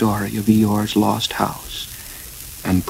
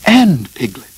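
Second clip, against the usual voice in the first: raised, louder excited voice; 5 semitones higher, 6 dB louder than usual. A narrator's reading. Christopher Robin names the second singer, excited. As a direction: add it with eager excitement. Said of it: "said Christopher Robin excitedly".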